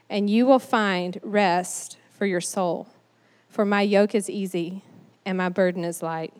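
soft, low, gentle scripture reading; hushed and reverent, with gentle pauses; tenderhearted. Acted. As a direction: pray it tenderly to the Father, unhurried. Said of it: "he's saying to them tenderheartedly".

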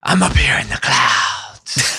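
slow breathy whisper of a gravel-voiced cowboy; Jack Palance impression. Friends riffing, imitating Jack Palance up in the clouds, slow whispery rasp. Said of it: "a Jack Palance impression".

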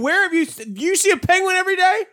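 high-pitched incredulous challenge; mock outrage. Real, not acted. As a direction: pitch shoots up in comic indignation.

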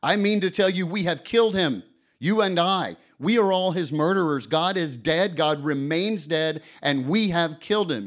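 high, loud, anguished proclamation; the madman accuses the laughing crowd; frantic madman. Acted. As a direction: declare it like a man possessed. Madman's speech read aloud.

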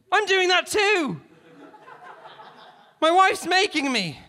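very high, loud outburst; blurting out. A narrator's reading. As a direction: blurt it out at a yell. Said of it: "Halliday blurted".

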